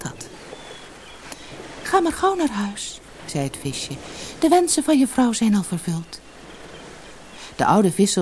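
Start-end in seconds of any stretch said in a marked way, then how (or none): 1.8-3.0 hoog, licht sprookjesvisje; gouden visje. Acted. Het visje spreekt. 4.4-6.2 hoog, licht sprookjesvisje; gouden visje. Acted. Het visje spreekt.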